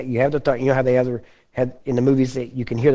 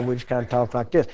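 understated Texan drawl, softer; 2 semitones lower, 6 dB quieter than usual. Shown as a demonstration. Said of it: "we just have slow drawl".

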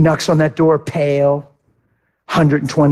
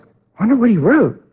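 curious; 8 semitones higher than usual. Retold or imitated. Preacher spotting his son's name, eager and curious. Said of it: "And I was like".

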